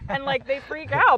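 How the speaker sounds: normal talking voice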